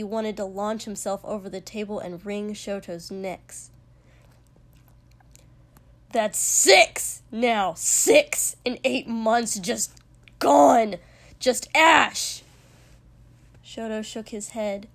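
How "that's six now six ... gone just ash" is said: loud, slow, hard-hit words; anguished, raging yell; enraged shouting. A narrator's reading. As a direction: punch out each count in fury. Enraged tally of destroyed villages.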